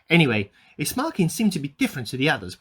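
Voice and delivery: ordinary talk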